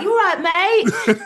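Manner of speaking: high, loud, laughing chummy call; butter her up; cheery matey greeting. Retold or imitated. James phoning the comic to book her, high and chummy.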